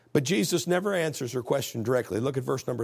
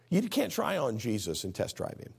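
usual speaking voice

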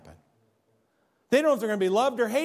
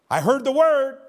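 emphatic declaration; 5 semitones higher, 8 dB louder than usual. Real, not acted. Preacher insisting on his point.

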